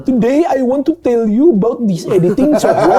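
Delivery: high, loud mock Indian English; laughing Indian-accent tutorial voice. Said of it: "tapi suara orang India". (aksen India sambil tertawa)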